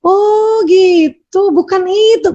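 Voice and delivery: high, drawn-out mock husband; lazy imitation of the husband, high and very slow; mock husband reply. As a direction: slowly shrug it off as the husband. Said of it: "Bisa juga dia jawab gitu".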